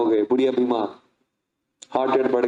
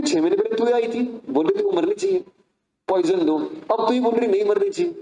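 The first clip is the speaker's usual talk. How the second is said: higher, sarcastic throwback of her words; mocking reproach; 7 semitones higher than usual. A brother mocking his sister's change of heart, sarcastic. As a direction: needle her with her own words.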